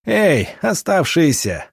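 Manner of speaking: high, slow call across the yard; commanding shout at the enemy's remaining men. A narrator's reading. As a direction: bellow it out to the men left standing. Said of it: "крикнул Гробовский".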